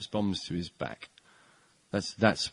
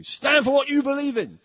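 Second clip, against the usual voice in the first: mock slogan; 5 dB louder than usual. Mocking the culture's slogan.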